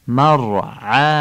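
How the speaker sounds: مرعاها with a thick guttural ع and a heavy ر. Shown as a demonstration. Teacher models مرعاها for the class. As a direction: press the ع in مرعاها from the throat.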